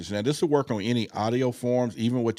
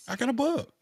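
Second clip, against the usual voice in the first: high excited realization; suddenly remembering, thrilled. Real, not acted.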